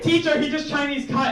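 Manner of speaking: tattle to the teacher; kid tattling. Retold or imitated. A kid reporting the line cutter to the teacher, whiny. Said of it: "We'd be like".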